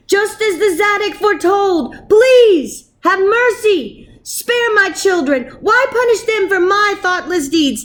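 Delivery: put-on father begging monster for children; pleading. A narrator's reading. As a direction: beg the monster for mercy. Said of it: "he pleaded for forgiveness".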